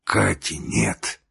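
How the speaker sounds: low-energy, slow croaked question; hoarse rasp. A narrator's reading. Dying brother asks about Katya, rasping. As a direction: rasp it out weakly and slowly. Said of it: "прохрипел он".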